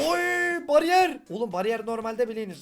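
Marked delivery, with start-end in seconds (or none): 0.1-1.2 high, loud thrilled shout; excited yelp. Real, not acted. Player shouting with joy at finding a barrier block. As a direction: burst out at the find.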